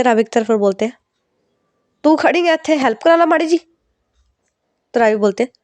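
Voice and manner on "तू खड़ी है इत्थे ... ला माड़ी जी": a busy mother, higher, snapping at her daughter; scolding mother. Acted. Mother scolds idle daughter to help, raised mock scold. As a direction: nag her to get up and help.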